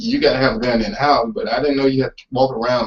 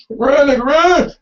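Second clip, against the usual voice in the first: shouting; 13 semitones higher, 5 dB louder, slower than usual. Retold or imitated. Acted yell at fleeing kid.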